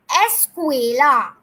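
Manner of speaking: slow, loud escuela, syllables drawn out; Spanish escuela. Shown as a demonstration. Teacher leads class repeating escuela slowly. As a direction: lead the class saying escuela. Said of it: "say it with the, together".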